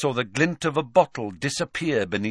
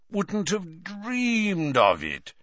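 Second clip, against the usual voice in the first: put-on slow, high, plummy old wizard; pompous Slughorn; 10 semitones higher, slower than usual. Slughorn answers Hagrid, slow and grandly. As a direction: purr it out grandly.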